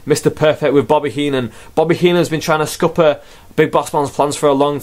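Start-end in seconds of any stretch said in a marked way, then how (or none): none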